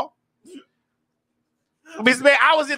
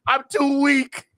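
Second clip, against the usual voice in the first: slow, feeble Palpatine whine; hammed-up feeble Sith lord; weak Palpatine; slower than usual. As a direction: play the dying Emperor, slowly. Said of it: "I'm over here like Palpatine".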